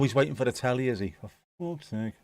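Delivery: ordinary delivery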